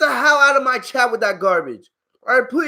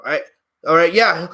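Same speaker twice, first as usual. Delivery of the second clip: ordinary delivery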